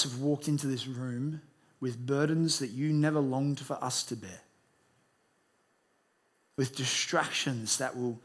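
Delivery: subdued congregational prayer. Real, not acted. Opens with a prayer.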